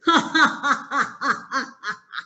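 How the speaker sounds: lion laughing at the mouse; lion's laugh. Acted. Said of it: "the lion started laughing".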